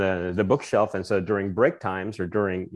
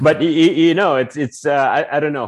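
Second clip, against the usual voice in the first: flustered laughing; 6 semitones higher, 8 dB louder than usual. Real, not acted.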